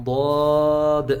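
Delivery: صاد slow, full-mouthed and loud; heavy saad. Shown as a demonstration. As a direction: fill the mouth and push out صاد. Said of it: "sound جو ہے وہ موٹی".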